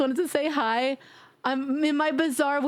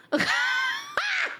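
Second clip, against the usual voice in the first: squealing laugh; high shrieking laugh. Real, not acted. Streamer hit by a chat prank, squealing laugh. Crowd control hits her.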